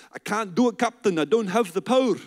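hammed-up Scottish accent. Preacher joking with Scottish people in the room, Scottish accent. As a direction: put on a playful Scottish burr. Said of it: "That's my Scottish accent".

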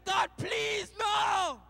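begging. Acted. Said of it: "the man begged and said".

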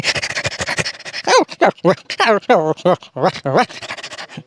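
long rising canine whine; mother dog whine. Acted. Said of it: "जानवरों की भी आप आवाज़".

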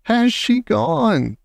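high, slow, fearful call; anxious shout. A narrator's reading. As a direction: draw out the worried question. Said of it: "I shouted again".

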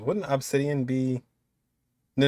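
usual speaking voice

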